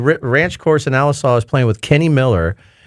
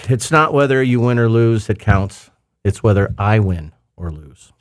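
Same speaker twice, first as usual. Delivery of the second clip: deadpan quip; 5 semitones lower than usual. Acted. Closing quip, slow.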